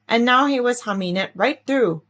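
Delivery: ordinary delivery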